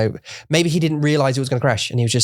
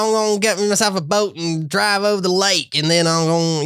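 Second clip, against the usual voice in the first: mock the Ozark woman's Missouri accent, much higher; 10 semitones higher than usual. Imitating the Ozark woman for a friend, in a hammed-up Missouri accent. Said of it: "Missouri accent or whatever it is".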